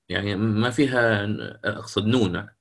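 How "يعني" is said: usual speaking voice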